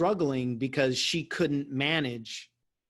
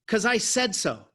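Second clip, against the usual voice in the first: mom voice; 7 semitones higher, 3 dB louder than usual. Retold or imitated. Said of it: "She goes".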